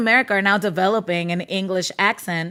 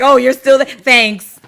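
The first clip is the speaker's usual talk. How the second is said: much louder, teasing sarcastic thanks; mock sarcastic reply; 3 semitones higher, 11 dB louder than usual. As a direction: play back his snarky quip. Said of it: "he's like".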